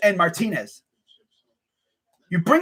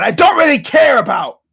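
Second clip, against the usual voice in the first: raised voice to talk over the rooster; louder over crowing; 4 semitones higher, 6 dB louder than usual. Real, not acted. Talking over the crowing rooster, raised voice. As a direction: push the words over the noise.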